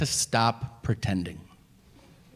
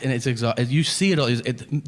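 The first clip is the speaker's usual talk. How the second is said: ordinary talk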